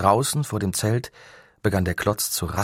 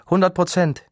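high, louder woman's call; 10 semitones higher, 6 dB louder than usual. A narrator's reading. Woman on the team calls the energy level to Miroslav, high and loud. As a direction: shout the reading across the tent. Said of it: "rief eine Frau".

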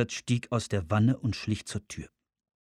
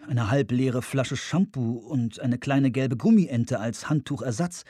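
ordinary delivery

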